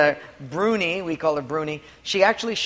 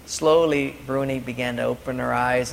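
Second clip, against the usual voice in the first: ordinary talk, nothing marked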